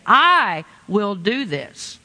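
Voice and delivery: long stressed I, measured finish; emphatic I. Retold or imitated. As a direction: hit I hard and let the rest fall. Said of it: "there is emphatic".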